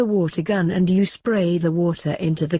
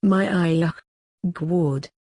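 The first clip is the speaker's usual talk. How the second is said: a hammed-up fake Australian accent, crying out; mock Australian-accented cries of pleasure; grandma yelling out in a bad fake Australian accent. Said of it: "she yells again".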